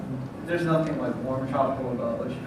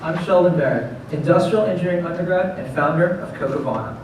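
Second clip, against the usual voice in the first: upbeat rehearsed sales pitch, projected; confident startup pitch; 5 semitones higher, 10 dB louder than usual. Acted. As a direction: sell it to investors with energy. Delivers rehearsed pitch to judges.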